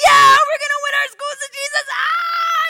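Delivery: mock the empty hype chant; hammed-up rally yell. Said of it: "huddle yelling and going".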